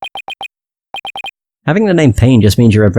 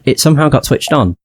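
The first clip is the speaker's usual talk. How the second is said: ordinary talk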